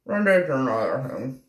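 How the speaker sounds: ordinary delivery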